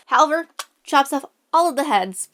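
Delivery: ordinary talk, nothing marked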